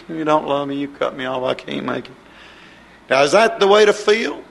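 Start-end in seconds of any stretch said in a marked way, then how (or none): 0.1-2.1 low, soft defeated complaint; mock-hopeless, much quieter and lower; acted despair. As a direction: imitate a believer giving up, voice sinking away. Voicing a despairing believer's complaint.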